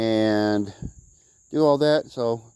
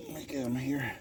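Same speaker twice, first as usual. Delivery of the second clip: whisper; 12 dB quieter than usual. Real, not acted. Quick aside while reaching.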